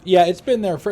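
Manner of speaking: loud burst on been; emphatic loud. Real, not acted. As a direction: blurt it out, stressing been. Reads chat, emphatic.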